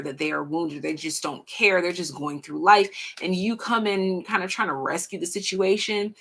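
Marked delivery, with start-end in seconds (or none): none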